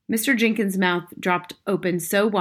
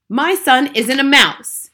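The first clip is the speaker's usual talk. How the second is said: slow, loud, high denial; outraged shout; 7 semitones higher, 10 dB louder, slower than usual. A narrator's reading. Father denying his boy is a mouse, slow outraged shout. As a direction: drag it out in a raised shout.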